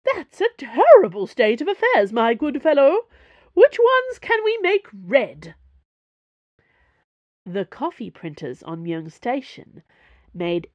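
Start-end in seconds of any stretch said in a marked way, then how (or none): 0.0-5.6 shrieking soap diva. Acted. Porthos fooling the salesman, shrieking like a soap diva. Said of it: "playing up an accent that tilted between new aristocrat and hollow soap diva".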